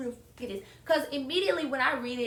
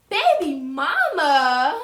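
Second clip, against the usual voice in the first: stunned disbelief; 4 semitones higher, 13 dB louder, much slower than usual. Retold or imitated. Stunned by baby mama's call.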